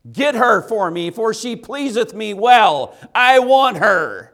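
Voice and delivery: put-on loud, high, insistent young man wanting his way; loud selfish demand, slowing at the end; demanding Samson. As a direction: slam down the last words like a spoiled demand.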